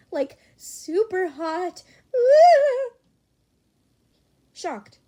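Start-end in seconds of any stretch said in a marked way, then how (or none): 0.1-2.9 high, slow, ending in a loud squeal; squealing fangirl. Acted. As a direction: melt over how hot he is. Chatter squeals over Mido.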